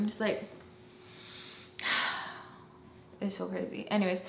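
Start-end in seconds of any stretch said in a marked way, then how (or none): 1.8-2.4 long quiet exhale; sigh. Real, not acted. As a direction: let out a soft, wordless sigh. Sighs remembering her past self.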